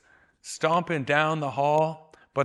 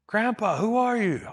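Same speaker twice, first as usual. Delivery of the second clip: a lighter raised call, higher; 7 semitones higher, 4 dB louder than usual. Retold or imitated. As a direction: call out, less force. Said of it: "And he yells again".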